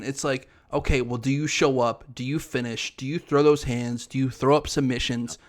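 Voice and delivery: ordinary talk, nothing marked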